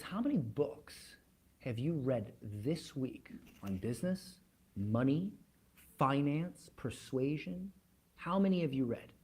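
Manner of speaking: soft, measured, serious questioning; real serious. Retold or imitated. Billionaire boss quizzing a salesman on reading, real serious. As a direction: quiz them gravely, leaving weighty gaps. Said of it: "he gets real serious".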